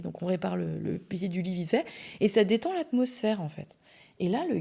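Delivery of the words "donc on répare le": usual speaking voice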